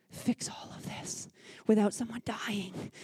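hushed whisper, sharing a wry secret; whispered aside. Real, not acted. Speaker whispering to the church audience about surviving chaos. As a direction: drop to a whisper for the punchline.

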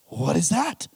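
rising, appalled question; retold disbelief at an awful sight. Preacher re-enacts shock at floor stain.

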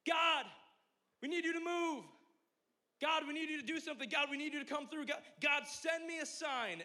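mock pleading prayer, high and loud; hammed-up loud begging prayer; desperate prayer. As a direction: cry out to God like a needy churchgoer. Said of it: "Anyone ever do this?".

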